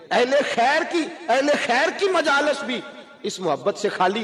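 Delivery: high, ringing preacher's cry; fervent sermon peak; impassioned preaching. Acted. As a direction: lift the whole hall with it. Preacher rises to full force.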